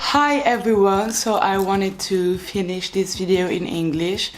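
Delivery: slow, careful French-accented English. Real, not acted. Finishing the video in English to prove fluency, French accent. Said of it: "je suis la seule avec mon accent".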